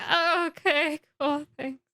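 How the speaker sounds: crushed acceptance. Retold or imitated. Harry acted crushed after rejection.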